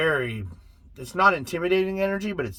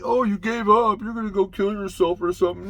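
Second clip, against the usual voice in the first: mocking quote; 6 semitones higher, 3 dB louder than usual. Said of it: "some people are like".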